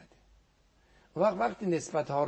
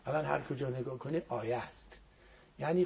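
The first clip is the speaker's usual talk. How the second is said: normal talking voice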